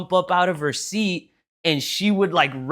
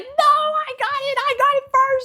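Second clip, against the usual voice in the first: mock toddler protest, pitched way up; fighting toddler. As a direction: squeal it like a kid fighting over a prize. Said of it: "she would start fighting us like".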